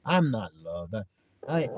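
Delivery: low, soft mock despair; unloved self-pity. The doubting heart talking, low unloved self-pity. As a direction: drop low and subdued, acting out self-pity. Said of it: "you hear how it's talking?".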